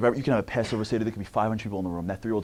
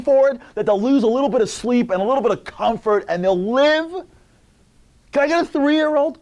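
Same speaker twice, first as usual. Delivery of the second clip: rising sermon cry; 10 semitones higher, 8 dB louder than usual. Real, not acted. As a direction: build to an impassioned call. Building sermon climax.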